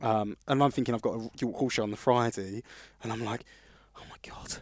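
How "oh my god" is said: breathless whispered panic; a whispered oh no under the breath; panicked whisper. Retold or imitated. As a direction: barely voice it, horrified. Panicked aside at hospital news.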